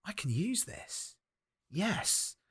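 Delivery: softly hatching a plan; scheming Shakespeare. Acted. Shakespeare spotting use for the odd clacker, slow hushed scheming. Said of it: "William Shakespeare's like".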